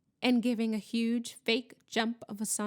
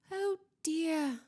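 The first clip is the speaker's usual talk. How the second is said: high, regretful sigh of words; sad; 7 semitones higher than usual. A narrator's reading. Student watches the ghost flee, sad. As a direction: let it out sorrowfully. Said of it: "said Hermione sadly".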